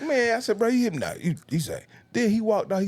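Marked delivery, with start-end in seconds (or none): 0.0-1.1 exasperated callout. Retold or imitated. Calling out repeat scammer.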